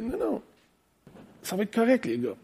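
soft, hushed reassurance; réconfort. Acted. Jesus voiced calming the panicked disciples. (réconfort tout doux, à voix basse)

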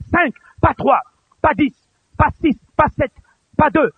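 high, emphatic, separated phrases; insistent counting. Real, not acted. Preacher insisting on exactly five animals, high and emphatic. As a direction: lift each number with a pause between.

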